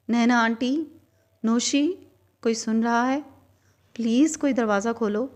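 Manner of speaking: locked in, she calls to the family for help; calling for help. A narrator's reading. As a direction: call out to the house, begging.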